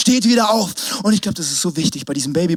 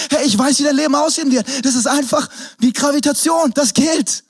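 rising preacher fervour, pitched up; preaching; 7 semitones higher than usual. Acted. Preacher to congregation, rising fervour. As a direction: drive it home like a preacher.

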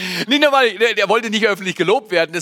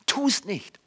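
quiet repeated warning; 2 semitones higher, 6 dB quieter than usual. Acted. Paulus warns against grumbling.

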